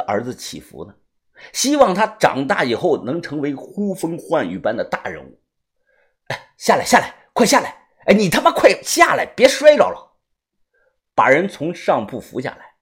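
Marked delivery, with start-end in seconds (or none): 6.3-10.2 urgent shouting. Acted. Narrator urging her down.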